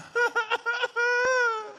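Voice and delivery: very high, long wailing bird call; eerie bird cry. Retold or imitated. Comedian imitating a sinister bird in the woods, very high wail. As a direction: wail like a child-crying bird.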